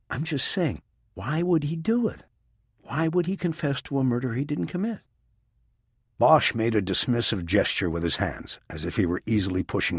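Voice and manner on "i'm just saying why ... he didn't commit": lightly voiced skeptical woman; a hint of a doubtful agent; female character voice. A narrator's reading. Rachel's voice in the narration.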